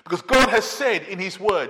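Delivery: ordinary delivery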